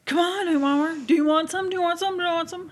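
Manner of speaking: high, repeated insistent offering; pushy offerer voice. Retold or imitated. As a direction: push the pizza on them. Acting out pizza offering.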